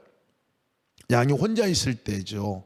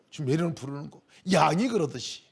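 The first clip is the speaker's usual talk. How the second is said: normal talking voice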